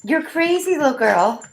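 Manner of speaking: high squeaky voice for a pet. Real, not acted. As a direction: coo at the dog in a high voice. Baby talk to dog.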